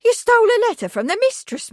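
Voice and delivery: high, loud, fast shocked accusation; horrified. A narrator's reading. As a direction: recoil in horror. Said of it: "stiffened, horrified".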